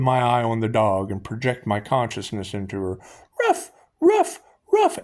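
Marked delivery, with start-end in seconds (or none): 3.5-5.0 very high, louder yapping barks; dog bark. Retold or imitated. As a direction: yap like a small dog. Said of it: "Imagine a bark".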